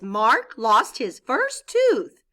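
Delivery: slow, loud excited read of a happy sentence. Shown as a demonstration. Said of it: "why they put an exclamation point".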